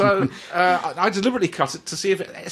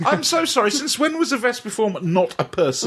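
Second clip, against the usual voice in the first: mock outrage; 7 semitones higher than usual. Real, not acted. Mock indignant objection.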